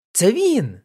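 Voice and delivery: high-pitched accusing cry; fiercely certain exclamation. A narrator's reading. Woman identifies the man to the policeman, fiercely certain. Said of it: "вигукнула жінка майже з лютою впевненістю".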